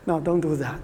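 ordinary delivery

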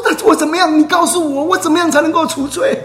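high-pitched retold imploring; desperate pleading. Woman begging Jesus how to lose her sin, desperate pleading. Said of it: "she was pleading and imploring".

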